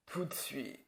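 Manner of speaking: a cold whisper after the verdict; whispered threat. Acted. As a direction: drop to a menacing whisper. Firing the set designer.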